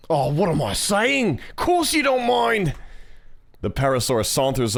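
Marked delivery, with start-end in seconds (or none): 0.1-2.7 slow, high, hammed-up Australian accent; Mia. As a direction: drag it out in bad, high-pitched Aussie. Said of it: "the shitty Australian accent".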